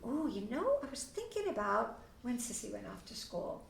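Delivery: put-on soft, gentle sympathy to a child; soothing parent. Shown as a demonstration. Parent comforting upset child, soft and gentle. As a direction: coax the child softly, hushed at the end. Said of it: "You're gonna be just kinda going".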